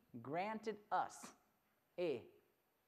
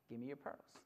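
low, hushed request; gentle dad; 8 semitones lower, 10 dB quieter than usual. Acted. Dad asking daughter for her pearls, low and gentle. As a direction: ask very softly, no pressure. Dad softly asks again.

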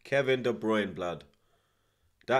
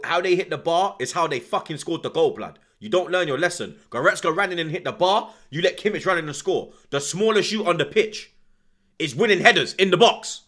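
getting louder and higher, furious at the defending; angry rant; 5 semitones higher, 5 dB louder than usual. Real, not acted. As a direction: build the rant into a real shout. Fan raging at defending.

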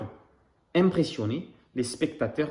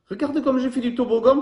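much higher and louder, playful boast; joking Alistair; 10 semitones higher, 6 dB louder than usual. Acted. Alistair jokes to baby koala while sliding, in a high playful voice. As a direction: show off your slide, teasing. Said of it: "plaisante Alistair".

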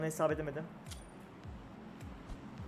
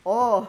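loud startled shriek at a sudden noise; jump-scared gamer yelling out; startled scream; 5 semitones higher, 13 dB louder than usual. Real, not acted. Gamer spooked by a noise outside, shrieks.

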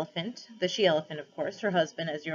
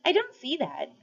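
doubtful bear; 9 semitones higher than usual. A narrator's reading. Bear disagreeing.

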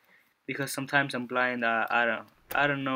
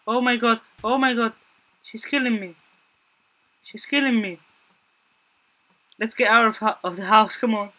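higher, louder frantic cries; panicked escape; 8 semitones higher, 7 dB louder than usual. Real, not acted. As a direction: scramble out of the house in fear. Fleeing the attacker.

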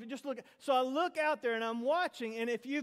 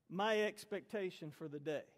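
normal talking voice

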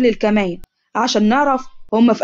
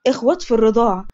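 plain reading voice

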